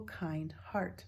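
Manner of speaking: usual speaking voice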